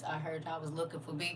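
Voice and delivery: hushed, quick cash doll voice. Shown as a demonstration. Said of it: "In my cash doll voice".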